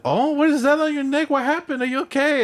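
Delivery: high, fussing fake concern; high mock-worried auntie voice; nosy aunt. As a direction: imitate a nosy aunt gasping over the neck. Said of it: "my aunt that lives there, nosy ass, was like".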